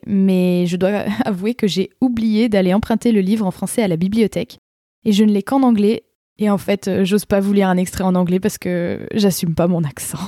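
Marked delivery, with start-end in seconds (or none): none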